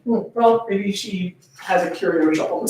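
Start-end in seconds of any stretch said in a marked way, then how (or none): none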